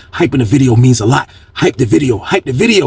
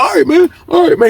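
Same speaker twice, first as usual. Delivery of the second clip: imitating the beaten man. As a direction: give in, scared. Victim surrenders again.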